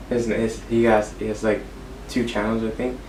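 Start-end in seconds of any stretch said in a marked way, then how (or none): none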